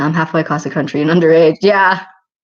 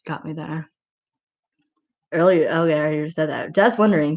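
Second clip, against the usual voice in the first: ordinary talk, nothing marked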